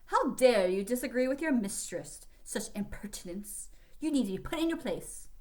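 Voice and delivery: mock the lieutenant in a pompous English accent; haughty English accent. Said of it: "drawled in a haughty English accent".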